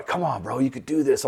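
soft, under the breath, coaxing himself; whisper-level nudge to your own nerve; quiet self-encouragement. Retold or imitated. In the back seat, quietly talking himself into it.

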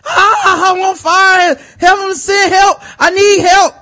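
high, loud panicked scream; burning scream. Retold or imitated. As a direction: scream for help while burning. S0 acts the burning caller.

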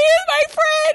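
high, slow, whiny mock of the girl; mocking high whine. Mocking the girl as she insists he's just a friend, high and whiny. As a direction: squeak it out like a lying girlfriend.

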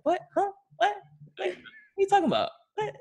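high, squeaky feigned confusion; mock innocent denial. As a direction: play dumb in a quick high voice. Feigns innocence when confronted, acted.

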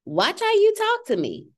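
high, sharp motherly rebuke; lightly voiced stern warning to her son; stern mom warning. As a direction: check the child's attitude. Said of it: "I've had to tell my eight-year-old".

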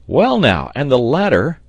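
loud, high exclamation; sailor's cry. A narrator's reading. Sailor wondering about the ladder, crying out. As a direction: cry it out in bafflement. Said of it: "cried the sailor".

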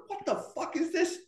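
high-pitched, hushed disbelief; incredulous. Retold or imitated. EMTs stepping off the elevator to the body, incredulous. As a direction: mimic a baffled, squeaky reaction.

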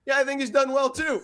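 high, punchy imitation of the coach; playful needling. Coach toying with the sideline reporter, playful needling.

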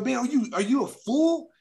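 high, incredulous mock anger; retelling the fight, mock outrage; retold outraged jab. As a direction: toss the insult up in disbelief. Retold insult to bully.

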